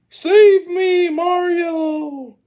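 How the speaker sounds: very high, loud damsel shout; mock cry for help. Captive King Kong to Mario, high-pitched shout for rescue. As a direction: shriek it out, stretching the words.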